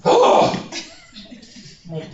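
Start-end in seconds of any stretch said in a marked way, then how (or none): 0.0-0.7 breathy high gasp of exaggerated dismay; mock gasp. Teacher overheated in the classroom, mock fainting gasp. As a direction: gasp like you're about to faint from the heat.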